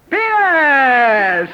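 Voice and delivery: put-on very loud, high, drawn-out toddler yell; little toddler. Naked Farquhar at family dinner, loud gleeful toddler shout. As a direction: announce it proudly at full volume. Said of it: "and goes".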